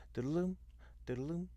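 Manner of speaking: lilting musical swoop, pitched high; imitated up-and-down figure. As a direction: sing the little arc of the tune. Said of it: "when I hear the up and down".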